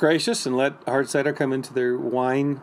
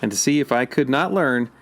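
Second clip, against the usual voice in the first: poem reading; 3 semitones lower, 4 dB louder than usual. Acted. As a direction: read it deliberately like a poem. Reads Thoreau quote aloud.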